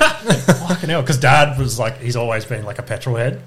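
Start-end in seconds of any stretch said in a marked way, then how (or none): 0.0-1.0 slow grumbled curse, laughing; unimpressed dad. Retold or imitated. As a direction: drag it out, arms folded.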